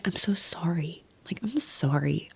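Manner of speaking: soft, breathy retold apology; tearful apology. Mother apologizing to her daughter after waking, mock tearful and hushed. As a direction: say sorry quietly, imitating a tearful moment. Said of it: "I just started sobbing".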